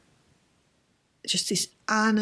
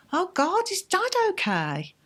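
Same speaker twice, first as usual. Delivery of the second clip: much higher, alarmed cry, retold; imitating her own panicked question, much higher; retold sudden panic; 12 semitones higher than usual. Sudden panic about her dad.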